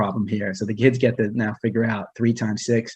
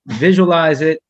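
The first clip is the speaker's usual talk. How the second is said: higher and louder, pressing the point; emphatic repeat; 5 semitones higher, 6 dB louder, slower than usual. Real, not acted. Speaker urging students to picture puppies, emphatic. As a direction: push it harder the second time.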